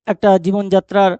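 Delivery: usual speaking voice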